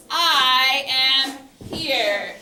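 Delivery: I am here stretched out slowly and loud; slow signal phrase. Shown as a demonstration. Teacher showing students the response, slow and loud. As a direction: say I am here slowly, each word weighted. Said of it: "So you're gonna say".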